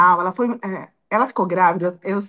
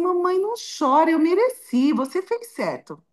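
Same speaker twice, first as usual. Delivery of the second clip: imitated consoling child; 7 semitones higher than usual. Scolded daughter comforting her crying mother, imitated consoling child. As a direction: lightly voice a child soothing mum.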